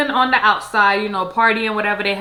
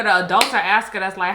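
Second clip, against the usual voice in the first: ordinary talk, nothing marked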